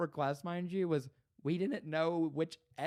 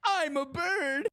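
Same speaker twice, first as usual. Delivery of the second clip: silly role-play voice; 10 semitones higher, 6 dB louder, slower than usual. Pretend.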